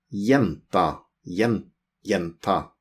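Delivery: jenta said as yenta, first syllable broken out; jenta with a Y sound. Shown as a demonstration. Teacher to learners, sounding out jenta. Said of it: "but we pronounce it".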